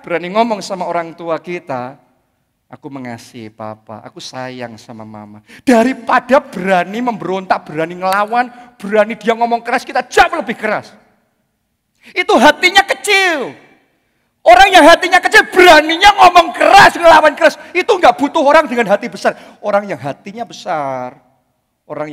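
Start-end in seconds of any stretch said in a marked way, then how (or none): 2.7-5.4 soft and low; quiet loving words to mum and dad; tender. Shown as a demonstration. Modelling loving words to parents. 5.6-19.3 louder, higher preaching voice; preacher building to a loud rebuke; rising preaching. Real, not acted.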